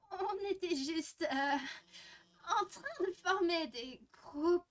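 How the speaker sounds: high, shaky flustered teacher; nervous teacher. Acted. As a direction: stammer like an anxious teacher.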